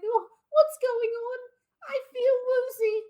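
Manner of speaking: very high, loud, fading-doll act; woozy toy. Acted. Toy losing its limbs, high woozy little-girl voice. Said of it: "And the toy's just like".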